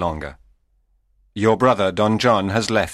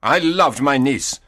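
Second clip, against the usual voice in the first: angry; 8 semitones higher than usual. A narrator's reading. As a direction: bristle and challenge him. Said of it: "Antonio stepped forward angrily".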